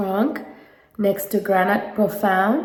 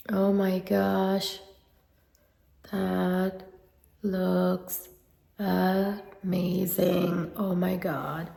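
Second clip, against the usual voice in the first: hushed awe; 2 semitones lower, 10 dB quieter, much slower than usual. Real, not acted. Awed at blush on her face.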